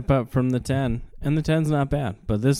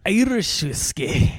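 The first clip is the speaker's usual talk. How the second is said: slow, higher, hammed-up Irish accent; bad Irish accent; 9 semitones higher, 4 dB louder, slower than usual. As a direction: try an Irish accent and miss. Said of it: "I can't do an Irish accent".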